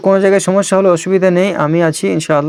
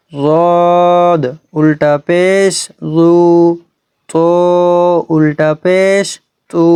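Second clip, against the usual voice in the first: drawn-out recitation drill; much slower than usual. Shown as a demonstration. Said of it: "চলুন আমরা পুরোটা পড়ে নিই".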